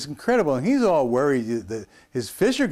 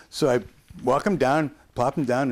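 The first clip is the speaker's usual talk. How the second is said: ordinary delivery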